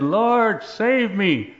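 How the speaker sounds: Peter's loud, high plea; desperate cry for help. Retold or imitated. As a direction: cry out as if sinking in the water. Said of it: "that's what he said".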